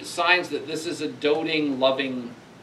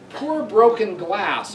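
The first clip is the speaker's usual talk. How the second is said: slow, higher, louder poem reading; recited verse; 7 semitones higher, 5 dB louder, slower than usual. Acted. Lecturer reads grieving father's lament to his dead daughter, recited verse.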